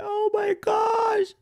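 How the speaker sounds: high mock crying wail, stretched slow; imitating a crying trader, high and slow. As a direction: wail like a panicked investor. People crying over crash.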